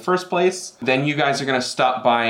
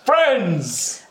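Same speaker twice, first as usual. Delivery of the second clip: lightly voiced large figure calling out, higher; a big figure's higher-pitched greeting, lightly acted; Benjamin's old glamour; 8 semitones higher than usual. Large figure greets the party in the bar, higher call. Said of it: "still talks with the same cadence".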